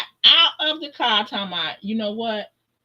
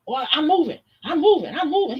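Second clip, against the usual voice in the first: nervous hurry; 7 semitones higher, faster than usual. Retold or imitated. Scared man backing down.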